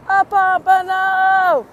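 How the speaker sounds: loud, high, slow exclamation; excited exclaim. Real, not acted. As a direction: stretch it out in delight. Spotting the fish.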